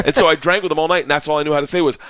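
usual speaking voice

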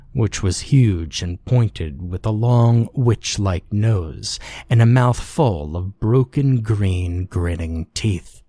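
plain reading voice

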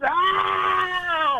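put-on sudden high yell from the old man; startled yell. Old man in the joke yells in alarm. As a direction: shout it out high and sharp.

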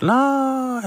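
high, sharp mom voice saying no; imitating mom snapping no; mom's flat refusal. Son retells mom refusing, in a high mock mom voice. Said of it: "And she, like, she just".

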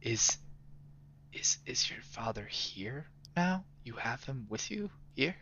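whispered, unvoiced and hushed; quiet tone. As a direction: lean in and barely whisper it. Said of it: "say in a quiet tone".

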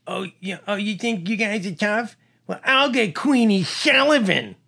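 Louis, toothless junkie. Retold or imitated. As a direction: imitate a wasted, toothless drunk talking tough. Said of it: "he said it just like that 'cause".

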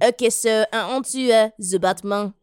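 mock-French accent, halting; playful put-on French accent. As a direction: keep up the fake French while moving on. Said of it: "You're doing a French accent".

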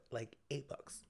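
ordinary talk, nothing marked

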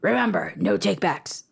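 gravelly sword vendor, hushed at the end. Acted. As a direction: play a shady sword vendor. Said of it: "Give me an impression of a character".

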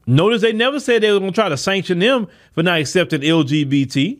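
usual speaking voice

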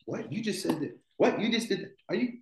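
high, disbelieving what?; can't believe what he's hearing; judge's stunned disbelief; incredulous. Retold or imitated. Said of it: "it was like".

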